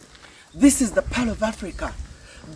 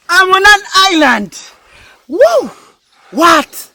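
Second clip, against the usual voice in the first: loud high joyful exclaim; thrilled shout; 10 semitones higher, 7 dB louder, slower than usual. Real, not acted. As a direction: burst out with joy. Arrives on island, thrilled.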